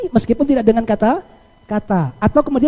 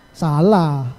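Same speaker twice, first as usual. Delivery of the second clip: SALAH low and falling, like a verdict; misleading intonation; 6 semitones lower than usual. Shown as a demonstration. Host testing listeners with an antonym, misleading falling intonation. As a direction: say SALAH as a low, final ruling. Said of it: "intonasi yang kurang tepat".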